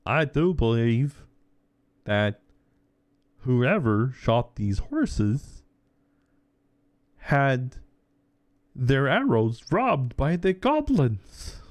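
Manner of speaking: drawn-out mock-wise character voice. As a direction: deliver it like a smug sleuth. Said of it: "chimes in with a character going".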